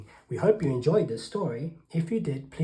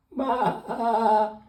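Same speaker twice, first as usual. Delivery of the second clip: loud, high imitated bleat; high goat "bah"; 7 semitones higher, 5 dB louder than usual. A narrator's reading. Goat's bleat voiced in story.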